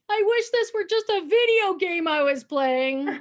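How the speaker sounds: put-on high, loud, slow wail of a little boy; crying little boy. Boy in freezing water, high slow crying whine. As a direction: whine it like a kid close to tears. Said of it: "starts crying".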